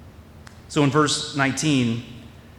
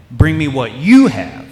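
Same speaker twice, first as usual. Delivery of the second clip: emphatic you; 8 dB louder, slower than usual. Shown as a demonstration. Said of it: "not the loaves, you".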